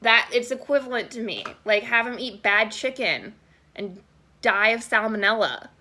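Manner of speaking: normal talking voice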